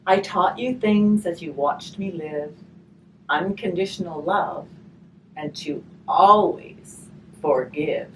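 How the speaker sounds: gentle storybook reading, slow on the rhymes; reading to children. Acted. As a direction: read the rhyme aloud to the children. Reading a rhymed picture book aloud.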